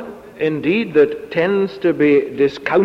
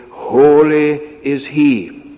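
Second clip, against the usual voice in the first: "Holy" leads, stretched slow and firm; stress on Holy; 4 dB louder, slower than usual. Shown as a demonstration. Preacher showing the psalm's refrain, slow stress on "Holy". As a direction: lean long and steady on "Holy". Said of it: "The emphasis is on the word holy".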